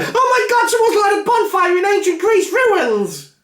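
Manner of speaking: high-pitched mock-hysterical newsflash; frantic news reaction. Podcaster imitating news crews spotting the bonfire, in mock hysteria. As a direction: shriek it like breathless breaking news.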